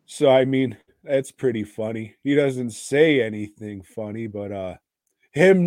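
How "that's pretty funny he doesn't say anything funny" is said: ordinary talk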